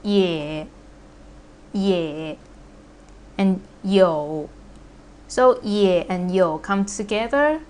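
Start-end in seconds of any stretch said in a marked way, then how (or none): 0.0-2.4 "ye" low and dipping, drawn out; third tone. Shown as a demonstration. Teacher modelling the third tone, low dipping "ye". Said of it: "the third tone". 3.8-4.5 "yo" dipping low, drawn out; third tone. Shown as a demonstration. Teacher modelling the third tone, dipping "yo". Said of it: "the third tone".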